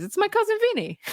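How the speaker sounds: high, proud mock reveal; Bill's punchline. Bill naming the family attorney, high mock reveal. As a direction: announce the cousin in a lifted voice. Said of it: "And Bill says".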